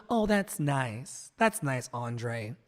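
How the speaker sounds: slow, softly trailing faint praise; beautiful gowns energy. Retold or imitated. Imitating people damning his work with faint praise, beautiful gowns energy. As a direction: mimic polite, empty praise, dropping away on the name. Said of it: "people will pay beautiful gowns energy".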